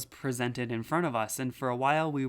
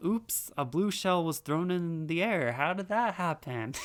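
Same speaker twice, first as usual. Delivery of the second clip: higher-pitched mock-innocent surprise; sarcastic; 5 semitones higher than usual. Pretending to rivals a hacked blue shell was accidental, sarcastic. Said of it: "sarcastically say".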